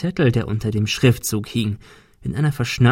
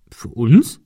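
surprised question, a bit louder; astonished; 5 dB louder than usual. A narrator's reading. Ben asks Kim, surprised and a bit louder, if it's for them. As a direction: Ben can't believe the gift is for him. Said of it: "fragte Ben erstaunt".

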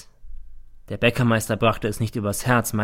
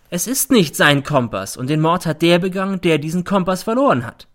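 raised, loud triumphant declaration; 7 semitones higher, 6 dB louder than usual. A narrator's reading. Mother turning to the judge, triumphant. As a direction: declare it as a victory. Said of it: "Sie wendet sich triumphierend".

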